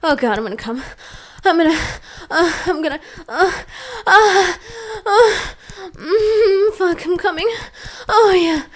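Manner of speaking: high, loud ecstatic cries; orgasm cries. Acted. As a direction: peak of pleasure, crying out. Acted orgasm.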